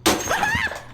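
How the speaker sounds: breathy high laughter. Real, not acted. Player laughs at the strange ending. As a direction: burst out laughing.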